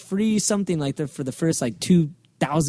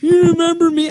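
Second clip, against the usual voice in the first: high squeaky imitation of a clingy fan; high, eager fan asking again; mock fanboy voice; 13 semitones higher, 4 dB louder than usual. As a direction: pitch it up like a needy fan. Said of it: "he's like".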